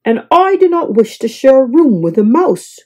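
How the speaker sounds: a touch of grumpy refusal; grumbling. A narrator's reading. Guest refusing mouse roommate, grumbling. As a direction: huff it out. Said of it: "grumbled Mister Snore".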